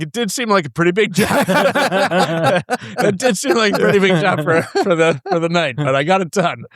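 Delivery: high, amused protest through laughs; talking through laughter. Retold or imitated. Brewer retelling his reply to the boss, laughing.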